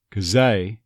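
Texan "'cause they" as "kuh-ZAY"; Texas-accented "'cause they", run together. Shown as a demonstration. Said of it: "in this case of Texas".

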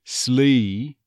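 "Slee" as "sluh-ee"; on-glide E. Shown as a demonstration. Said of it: "get an on glide onto that E".

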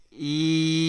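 "yes" with the vowel buzzing far forward in the mouth; twangy forward yes. Shown as a demonstration. Coach showing East Texas twang on a word, forward "yes". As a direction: carry the forward "ee" buzz into "yes". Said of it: "carry that into the word".

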